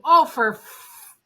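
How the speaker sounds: loud, slow, drawn-out half curse; dad cutting off a curse. Retold or imitated. A frustrated dad stops himself mid-curse, a loud drawn-out sound. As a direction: bite off the swear word. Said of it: "he'd go".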